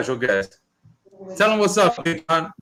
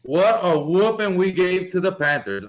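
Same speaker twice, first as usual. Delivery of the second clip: ordinary talk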